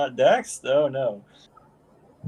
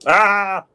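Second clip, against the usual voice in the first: sharp high yelp; startled yelp; 8 semitones higher, 7 dB louder than usual. Pretend. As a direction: yelp as your tongue is grabbed. Tongue grabbed by Mage Hand.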